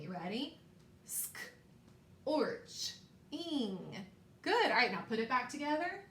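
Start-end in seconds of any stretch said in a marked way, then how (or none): 1.1-4.1 "Sk, orch, ing" with long pauses between parts; word in parts. Shown as a demonstration. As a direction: sound out "Sk, orch, ing", the Sk as a soft bare blend. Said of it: "Let's do that again".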